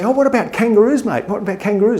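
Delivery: high-pitched mock questioner; eager American. Gullible American asking the Aussie about kangaroos, eager mimicry. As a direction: ask eagerly, pitched well up.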